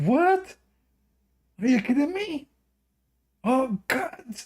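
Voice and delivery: very high-pitched, incredulous outrage; angry woman. Retold or imitated. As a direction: imitate an offended woman, voice way up. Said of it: "like, so angry".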